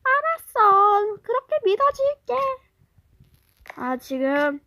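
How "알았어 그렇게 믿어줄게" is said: 높고 크게 비꼬는 말투; 못 믿겠다는 듯 빈정대기; sarcastic classmate. Acted. 친구가 비꼼. (친구를 비꼬는 높은 목소리)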